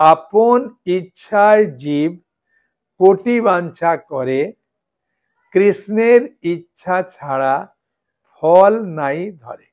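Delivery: slow, measured recital with pauses between phrases; slow verse recitation. Shown as a demonstration. Recites a verse for note-taking.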